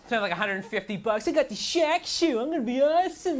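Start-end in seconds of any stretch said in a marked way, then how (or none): 1.3-3.4 mock dumb kid. Kid bragging about new shoes.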